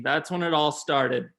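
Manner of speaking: ordinary delivery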